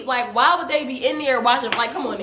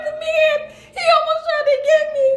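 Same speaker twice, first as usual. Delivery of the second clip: very high, squeaky tearful whine; mock crying. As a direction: wail it like a hammed-up sobbing retelling. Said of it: "I'm just crying like".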